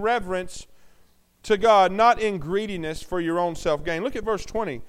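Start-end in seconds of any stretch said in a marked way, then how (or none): none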